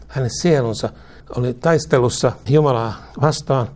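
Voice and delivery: ordinary talk, nothing marked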